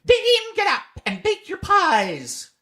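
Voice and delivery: very high, slow rooster cry; crowing cock. A narrator's reading. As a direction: crow it out like a cock at dawn. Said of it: "the cocks cried out".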